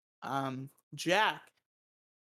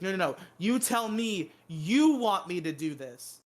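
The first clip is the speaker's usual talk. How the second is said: defiant outburst; 3 semitones higher, 5 dB louder than usual. Retold or imitated. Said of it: "And Jesse's like".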